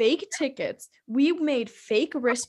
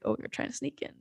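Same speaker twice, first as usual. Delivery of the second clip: hushed unvoiced aside; whispered confession; 10 dB quieter, much faster than usual. Retold confession to dad.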